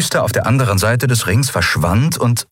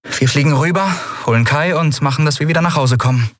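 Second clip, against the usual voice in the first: put-on higher, quick young captain pressing on; brisk captain; 8 semitones higher, faster than usual. A narrator's reading. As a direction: hurry the crew along, lightly. Endriel voiced in story.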